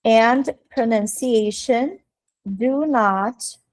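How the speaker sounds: slow, measured English, word by word; slow dictation. Shown as a demonstration. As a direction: dictate in small pieces for students. Dictating the phrase while writing.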